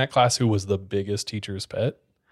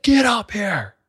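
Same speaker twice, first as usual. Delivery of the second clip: imitated yell; 8 semitones higher, slower than usual. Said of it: "he's screaming at my face".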